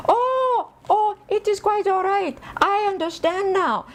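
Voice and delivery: put-on high-pitched Indian-accented doctor. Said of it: "she was Indian".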